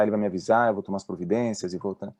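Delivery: ordinary talk, nothing marked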